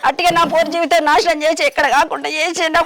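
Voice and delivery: high, distressed outpouring of grief; anguished lament. Acted. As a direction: wail over a child's ruined life. Mother laments daughter's ruined life.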